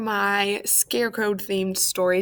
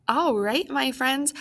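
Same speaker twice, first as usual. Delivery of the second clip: ordinary delivery, nothing marked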